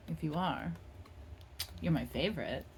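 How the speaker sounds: usual speaking voice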